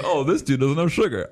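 lightly mock the teacher's realization. Teacher voiced.

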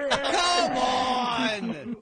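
cracking up while shouting; laughing shout. Real, not acted. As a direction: yell through laughter. Laughing shout at vomit gag.

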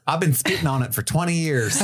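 folksy twang, played for laughs; Southern Dementor. Dementor chatting with guests, high comic Southern accent. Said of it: "I love the Southern Dementor bit".

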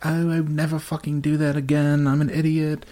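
mock her beating herself up; self-berating. Imitates a self-berating sad contestant.

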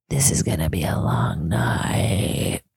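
low, slow whisper to herself; whispered aside. Retold or imitated. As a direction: breathe it out like a weary secret. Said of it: "I was just like".

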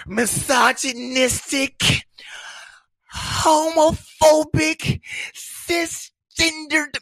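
high, slow mock outrage; high, drawn-out indignant voice; mocking outraged critics. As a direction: imitate the offended online crowd. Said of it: "They gonna hit me with that".

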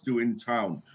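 ordinary talk, nothing marked

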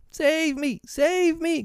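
put-on high-pitched boyish plea for help; panicked little boy, voice pitched right up; frightened cry. A narrator's reading. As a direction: cry out for rescue in a high child's voice. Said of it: "cried Nibs".

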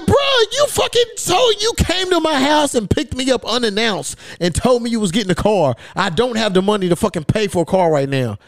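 high, incredulous rant that gradually comes down; indignant protest. Retold or imitated. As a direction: start way up high in outraged disbelief, then settle. Retells protesting to his uncle.

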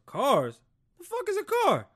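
loud, very high squawk of disbelief; baffled outburst. Acted. Horse-riding Pops hearing of cars, baffled outburst. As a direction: balk at a word you've never heard.

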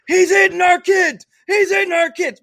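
yelled high and hard in outrage; furious shout. Retold or imitated. Rival coach screaming at the umpire, furious shout.

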